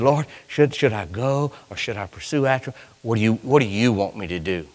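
quiet, low questioning; soft prayer. Retold or imitated. David quietly asking the Lord for guidance. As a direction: ask the Lord softly. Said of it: "So he said".

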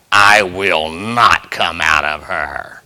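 deep, slow demonic growl; low and slow like a devil refusing to leave. Retold or imitated. Preacher voicing a demon refusing to come out, deep and slow. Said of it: "I've heard him speak out".

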